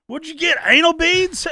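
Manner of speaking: high, loud imitation of a tactless guest; mock loud question. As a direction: blurt the question to the whole room. Acted quote of loud blurting guest.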